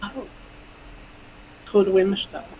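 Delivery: ordinary talk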